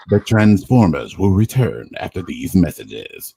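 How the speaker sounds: low, slow TV bumper announcer; Vic Caroli. Acted. Guest attempts an old cartoon bumper voice, low slow announcer. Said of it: "he was the guy that did".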